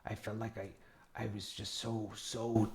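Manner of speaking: very soft, confiding character voice; soft-spoken Yarth. Acted. Yarth answering a player about sleep, very softly.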